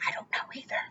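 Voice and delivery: breathy whisper, almost no voice; whispered aside. Real, not acted. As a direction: whisper it like a shared secret. Aside to child.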